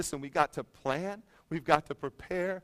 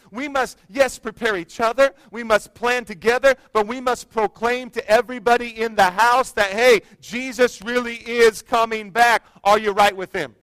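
higher and louder, driving sermon cadence; full-force preaching; 7 semitones higher, 10 dB louder than usual. Acted. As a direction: build the sermon to a loud, ringing call.

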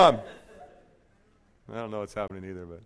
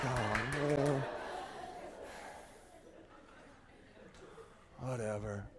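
unimpressed guys; 6 semitones lower, 16 dB quieter, much slower than usual. Retold or imitated. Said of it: "all the guys are like".

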